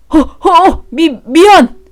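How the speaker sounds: panicked stammering sorry; flustered stammer. Acted. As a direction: caught out, fumbling for sorry. Caught man stammers apology.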